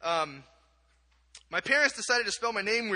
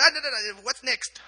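higher, impatient Kenyan lady; imitated Kenyan-accented brush-off; 6 semitones higher, 4 dB louder than usual. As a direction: cut the child off in a put-on Kenyan accent. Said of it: "I'll do the accent".

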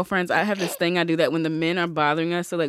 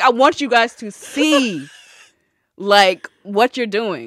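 slow, louder, theatrical lecture; dramatic scolding; 3 semitones higher, 6 dB louder, much slower than usual. Retold or imitated. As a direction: spell it out with great drama. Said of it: "I get so dramatic".

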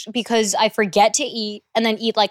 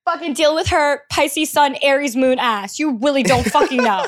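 hammed-up feisty threat, pitched up; sassy warning; 4 semitones higher, 4 dB louder than usual. Speaker warns friends not to test their temper, sassy. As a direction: warn them off with mock bravado.

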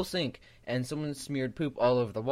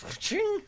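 high-pitched ka-ching sound effect; imitated clang; 4 dB quieter than usual. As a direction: mimic the knife's metallic clang in a high voice. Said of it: "It goes".

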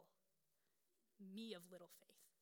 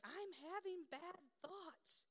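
put-on scared little girl; 9 semitones higher, much slower than usual. Quoting scared seven-year-old daughter.

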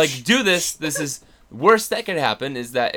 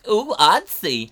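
high, slow mock-fancy exclamation; mock pretentious; 6 semitones higher, 5 dB louder, slower than usual. Guest spoofing his own praise of artsy Melbourne, affected and slow. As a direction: draw it out in a teasing, affected way.

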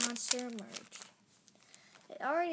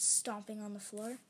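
plain reading voice

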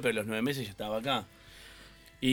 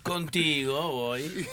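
Contigo voy stretched very slow; slow Uruguayan drawl; 3 semitones higher, much slower than usual. Shown as a demonstration. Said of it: "es con ese tono".

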